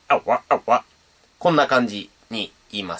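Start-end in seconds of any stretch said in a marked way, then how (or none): 0.1-0.8 low throaty "あわ、あわ" from the back of the throat; low voice scratch. Shown as a demonstration. Beatbox teacher demos low voice scratch "あわ". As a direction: drop the voice and growl "あわ". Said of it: "低い声であわっていうときは".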